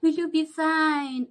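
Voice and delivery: high, slow mock concern; mock caring voice. Mocking the nurses who never asked how she was, high and slow. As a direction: imitate a fake-caring nurse. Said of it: "No one is saying".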